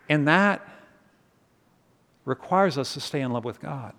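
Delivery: hushed devotional ending, each phrase left hanging; prayerful sermon close. Acted. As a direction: bring them gently into prayer. Closing prayerful sermon lines.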